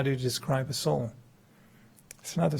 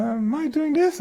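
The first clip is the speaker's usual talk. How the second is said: incredulous question; 3 dB louder than usual. Retold or imitated. Acts disbelief at own habit.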